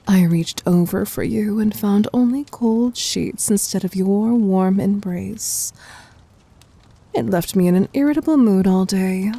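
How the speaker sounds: normal talking voice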